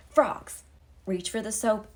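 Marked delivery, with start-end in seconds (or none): 0.1-0.6 loud punchline. Acted. Narrator springing frogs on the viewer, loud high shout.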